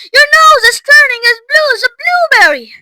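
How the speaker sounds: shrieked high and loud; panicked scream. A narrator's reading. Mother to Violet about her nose, screaming in panic. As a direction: scream the whole alarm out. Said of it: "screamed Missus Beauregard".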